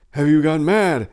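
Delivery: astonished terror. A narrator's reading. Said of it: "asked Miago in astonished terror".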